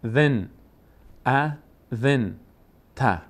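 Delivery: اذنت stretched very slowly; slow modelled reading. Shown as a demonstration. Teacher models اذنت slowly for learners. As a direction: draw اذنت out syllable by syllable. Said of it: "کسرهٔ زال میچسبه به نون".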